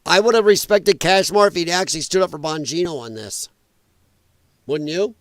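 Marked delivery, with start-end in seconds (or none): none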